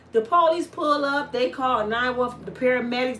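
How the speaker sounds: normal talking voice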